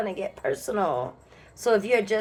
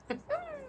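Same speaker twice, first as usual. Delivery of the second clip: high giggle; 11 dB quieter than usual. Real, not acted. Giggle after blessing.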